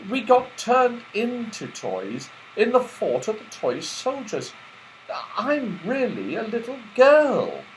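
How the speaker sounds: put-on high, earnest girl's voice; little girl Beth. Beth telling Santa they are not real toys, high and earnest. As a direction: explain earnestly.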